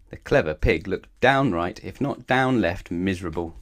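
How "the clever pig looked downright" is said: plain reading voice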